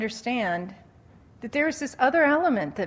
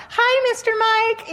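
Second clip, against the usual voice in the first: very high, slow, sing-song greeting; a starry-eyed little girl calling out; smitten kids; 13 semitones higher, 5 dB louder, slower than usual. Retold or imitated. Young girls biking by his house, high and smitten. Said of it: "Even the eleven-year-olds".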